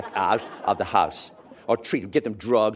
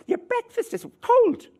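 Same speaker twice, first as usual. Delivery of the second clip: mother shouting; 6 dB louder than usual. Retold or imitated. Mother calls upstairs to hungover child, shouting. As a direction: yell up the stairs like an Irish mother. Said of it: "your mother shouts out".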